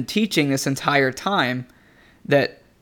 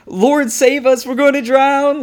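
high, loud panicked cry to be saved; mock panic, pitched way up; freaking out; 10 semitones higher, 5 dB louder than usual. Disciples waking Jesus in the storm, freaking out. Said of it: "they're freaking out".

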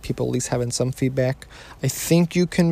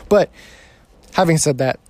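usual speaking voice

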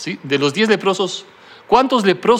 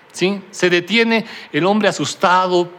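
normal talking voice

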